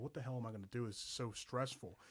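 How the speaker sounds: anxious inner voice. Retold or imitated. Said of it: "I was like".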